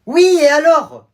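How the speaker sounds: exasperated retort. Acted. Teacher answering the zebra complaint, loud exasperated retort. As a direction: throw it back impatiently.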